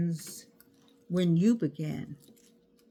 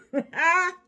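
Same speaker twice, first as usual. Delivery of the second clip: loud gleeful laugh, pitched up; excited laugh; 10 semitones higher, 6 dB louder than usual. Real, not acted. Card fan laughing in excitement, loud laugh. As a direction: laugh with delight. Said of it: "I got excited".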